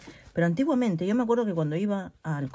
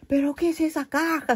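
startled husband; 9 semitones higher than usual. Retold or imitated. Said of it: "sube y dice".

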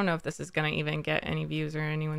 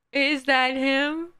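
high question, slowly stretched out; drawn-out question; 6 semitones higher, slower than usual. Retold or imitated. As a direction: draw the question out in raised pitch. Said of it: "I was like".

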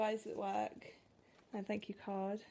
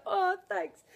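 retold thanks; 9 semitones higher, 4 dB louder than usual. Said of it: "I was like".

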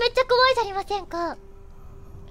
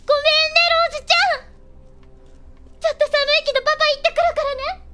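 悲痛な呼びかけ; 3 semitones higher than usual. Pretend. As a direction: call out an anguished apology and promise to a child. 父親として娘に呼びかける. (娘に必死で謝る父親の悲痛な声)

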